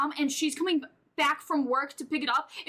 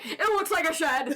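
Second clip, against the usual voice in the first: loud, raised-pitch parody of a blunt remark; mocking imitation; 5 semitones higher, 3 dB louder than usual. Host parrots her co-host's deadpan remark in a loud mock voice. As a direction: throw the friend's line back at her, teasing. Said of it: "and you're just like".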